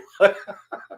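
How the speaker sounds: quick high laugh; bright laugh. Real, not acted. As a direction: burst out laughing briefly. Laughs at chat comment.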